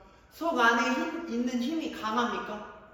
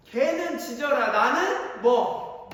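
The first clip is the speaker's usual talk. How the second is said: mock defiant; 5 semitones higher, 7 dB louder, much slower than usual. Acting defiant kid's reply.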